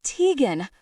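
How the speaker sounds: loud, high roar of her name. Acted. Noah roars Tegan's name searching the bar. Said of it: "I roared".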